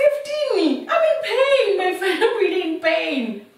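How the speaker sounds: very high, loud pained protest; outraged outburst. Retold or imitated. Labouring woman told to wait fifteen minutes, outraged. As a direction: wail it in disbelief, re-enacting the moment.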